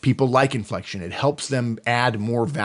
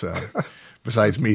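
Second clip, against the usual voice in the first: usual speaking voice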